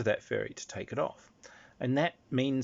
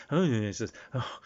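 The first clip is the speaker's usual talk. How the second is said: ordinary talk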